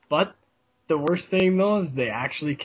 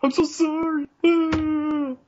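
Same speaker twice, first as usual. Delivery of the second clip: much higher, louder mock whine; whiny apology; 14 semitones higher, 5 dB louder, much slower than usual. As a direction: whimper it like an overblown crybaby. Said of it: "being a whiny bitch".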